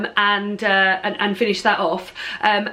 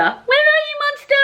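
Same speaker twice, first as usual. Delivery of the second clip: high sing-song call to the cat; calling the pet; 3 dB louder than usual. Real, not acted. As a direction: call the cat from across the room, much higher.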